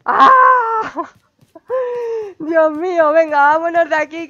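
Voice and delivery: scared. Real, not acted. Spooky room, wants to leave.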